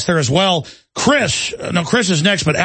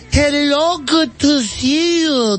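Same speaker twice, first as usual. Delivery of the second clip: mocking, much higher, slowed, over-careful greeting; Rick Perry impression; 9 semitones higher, much slower than usual. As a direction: ham up a politician talking down to people. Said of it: "went out to California and went".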